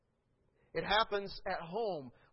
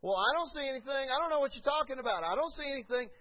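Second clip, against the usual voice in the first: higher, louder feigned ignorance; playing dumb; 8 semitones higher, 5 dB louder than usual. Believer dodging God, mock innocent. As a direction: brush it off fast. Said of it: "if you continue to do this".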